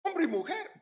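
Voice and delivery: normal talking voice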